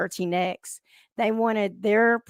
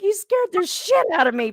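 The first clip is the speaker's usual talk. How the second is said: imitating scared daughter; 8 semitones higher than usual. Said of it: "She's like".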